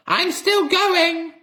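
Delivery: very high, loud, slow screech; Grandma screeching triumphantly as she grows; screechy old hag. A narrator's reading. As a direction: crow it out like a mad witch. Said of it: "the old screechy voice".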